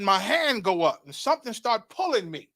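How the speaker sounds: ordinary talk, nothing marked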